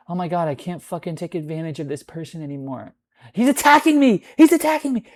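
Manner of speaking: high, loud mock victim cry of "He's attacking me"; mocking whine. Mocking people who can't manipulate him, whining and high. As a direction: play the whiny victim, mocking.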